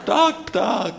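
high-pitched bird agreeing; bird squawk. A narrator's reading. Third bird agrees with the snake, bird squawk.

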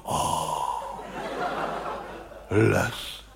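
a hushed, hammed-up gasp of wonder; mock awed gasp. Theatre audience marvelling at the scenery, mock awe. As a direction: play the gawping audience, breathless. Audience gasps at painted forest.

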